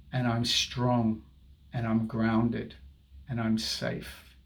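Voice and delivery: slow affirmation. Shown as a demonstration. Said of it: "Say it with me".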